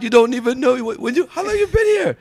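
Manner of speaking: ribbing a friend in mock shock; excited teasing. Real, not acted. Teasing disbelief at S2's accent.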